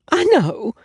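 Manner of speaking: high, pitiful little admission. A narrator's reading. Weepy client admitting her hair disaster, pitiful and high. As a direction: sound small and pathetic. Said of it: "she said pitifully".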